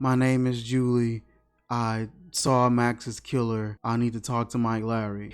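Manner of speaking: soft, low, deadpan calm; flat calm Julie. Retold or imitated. Mocking Julie calmly reporting a murder, soft and deadpan. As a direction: imitate her as flat and unbothered. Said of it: "that was literally the tone she said it".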